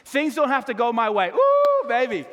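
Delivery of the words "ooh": loud, high-pitched "ooh"; high whoop. Real, not acted. Whoops after announcing point two.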